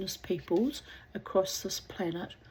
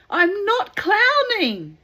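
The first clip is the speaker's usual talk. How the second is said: desperate insisting; 13 semitones higher, 11 dB louder, slower than usual. Acted. Drowning man insists.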